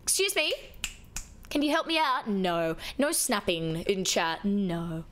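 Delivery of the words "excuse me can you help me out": higher, lightly voiced demanding customer; a hint of a needy shop customer; mock customer calling for help; customer impression. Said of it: "when people go".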